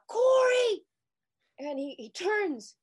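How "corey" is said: high, loud scream of his name; desperate shout to a long-lost brother. Retold or imitated. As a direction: yell it out across the beach. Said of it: "And I scream out".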